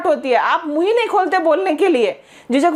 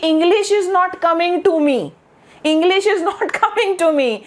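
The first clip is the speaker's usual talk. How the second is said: slow, higher-pitched literal translation; quoting a child's translation; 3 semitones higher, much slower than usual. Retold or imitated. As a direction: drag out a learner's word-for-word English, pitched up. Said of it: "इसका मैंने translation सुना है एक बच्चे से". (बच्चे का अनुवाद धीरे-धीरे दोहराते हुए)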